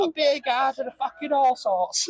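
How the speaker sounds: high, badly faked Cockney; Cockney accent. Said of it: "can't do an accent".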